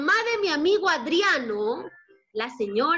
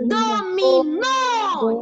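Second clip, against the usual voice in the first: "Dominó" slow and higher, stressed on the final "nó"; stress on last syllable; 4 semitones higher, 4 dB louder, much slower than usual. Shown as a demonstration. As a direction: raise and stretch "Dominó", leaning on the last O. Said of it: "Lleva tilde en la última". ("Dominó" lento y agudo, con acento en la última O)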